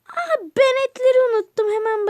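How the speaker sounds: high startled oh no; sudden alarm. Acted. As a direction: gasp then high, loud realization. Aunt remembers the meat.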